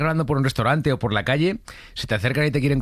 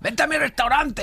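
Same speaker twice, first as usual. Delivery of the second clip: very high, drawn-out mock fan call; pushy fan; slower than usual. Imitating someone inviting him to their restaurant, high caricature voice. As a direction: squeal it slowly like an over-keen fan. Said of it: "entra en plan".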